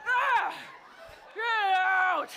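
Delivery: loud, high mock-rage yelling; venting shout. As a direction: yell it out to fire up the crowd. Urging crowd to vent rage.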